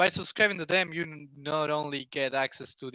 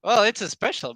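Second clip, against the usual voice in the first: loud; 7 semitones higher, 9 dB louder than usual. Real, not acted. Hawking the special price.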